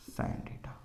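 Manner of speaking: whispered finish; hushed answer. Shown as a demonstration. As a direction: trail off into a whisper.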